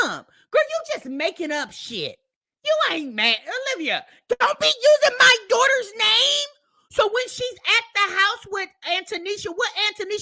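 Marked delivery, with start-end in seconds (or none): 3.7-6.5 higher, louder mocking imitation of the mother; mock complaint. Creator mimics mother scolding the fiancée, higher mock shout. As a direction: parody her whiny complaint, pitched up.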